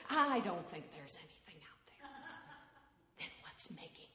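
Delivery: low, unvoiced hush; whispering. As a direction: keep it to a whisper. Whispered exchange in dark tent.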